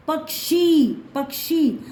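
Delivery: पक्षी with k closed before a drawn-out shee; teacher carefully models the k inside पक्षी; half-k in पक्षी. Shown as a demonstration. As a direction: sound out पक्षी as "pak-shee". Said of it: "बीच में हमको क लगाना पड़ेगा".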